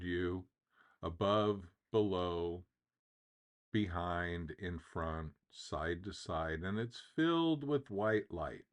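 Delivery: guided visualization. Real, not acted. Guide asks listeners to close their eyes and imagine, calm meditative delivery. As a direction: ease them into the imagery, letting phrases settle.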